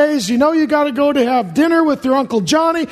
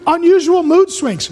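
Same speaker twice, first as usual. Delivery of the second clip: ordinary talk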